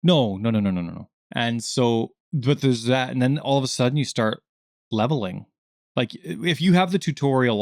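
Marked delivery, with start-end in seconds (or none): none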